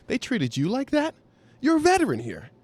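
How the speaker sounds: put-on high, incredulous old friend; disbelieving buddy. Old buddy hearing the story, in high disbelief. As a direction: sound stunned on his behalf.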